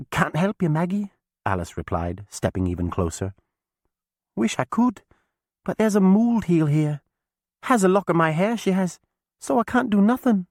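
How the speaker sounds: put-on young witch girl, sorry for her; Alice, sympathetic girl. A narrator's reading. Alice greets chained Maggie, high and sorry.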